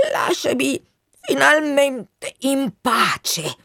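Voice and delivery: weary exasperation. Acted. Colonel wants the Wind gone, weary and exasperated. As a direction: beg to be left alone, word by laboured word. Dying colonel sends friend away.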